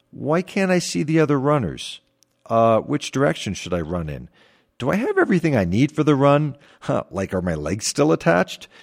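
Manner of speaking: lightly acted bewildered self-talk; puzzled overthinking. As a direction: act out a mind second-guessing itself. Acted anxious inner questions on racecourse.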